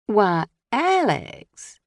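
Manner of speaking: slow, drawn-out Southern belle drawl; mock Southern belle accent; Scarlett O'Hara accent. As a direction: tease him like a Southern belle. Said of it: "I took on a Scarlett O'Hara accent".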